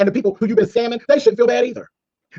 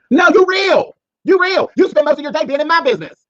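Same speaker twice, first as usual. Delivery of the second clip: mock outburst; 7 semitones higher, 4 dB louder than usual. Mocking answer to Rico.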